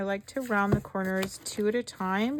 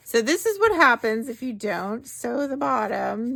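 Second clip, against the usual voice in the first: excited; 4 semitones higher, 8 dB louder than usual. Real, not acted. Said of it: "I got a little excited there".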